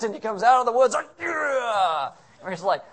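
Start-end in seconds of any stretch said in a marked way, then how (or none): 1.2-2.1 wild yell. Retold or imitated. Wild prophet bursting out.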